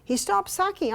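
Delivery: normal talking voice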